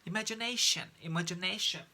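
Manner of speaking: imagination said with the sh sound, not a hard T; imagination as spoken. Shown as a demonstration. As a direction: model imagination with the sh sound for the class. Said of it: "there's a T here, but we say imagination".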